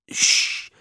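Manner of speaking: an urgent hushing whisper. A narrator's reading. Mister Bray hushing Ralph as Madeline approaches, whispered. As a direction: hush him before she hears. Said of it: "whispered Mister Bray".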